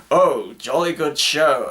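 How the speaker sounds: slow, hammed-up British toff; mock jolly British businessman accent; put-on British accent. Disguised agent impersonating British businessman, hammed-up British accent. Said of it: "he's trying to put on a British accent".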